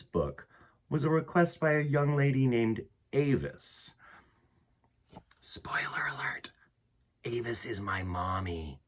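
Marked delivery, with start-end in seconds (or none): 5.4-8.8 whispered secret aside. Real, not acted. Whispered aside about his mom.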